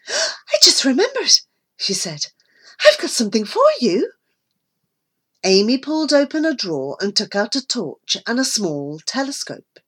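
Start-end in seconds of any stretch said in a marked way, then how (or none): 0.1-4.1 bright eager. Acted. Amy cheers the boys up.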